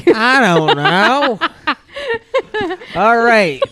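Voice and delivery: high, loud laughing sign-off. Real, not acted. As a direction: laugh through the outro.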